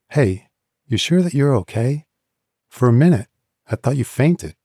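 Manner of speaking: Callista, concerned. A narrator's reading. Callista's voice, narrator.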